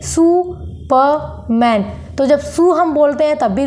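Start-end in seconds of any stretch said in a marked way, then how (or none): 0.0-1.9 Superman slowly in three separate beats; split into three syllables. Shown as a demonstration. Teacher repeating the pauses, Superman broken in three. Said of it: "दो बार हम pause ले रहे हैं".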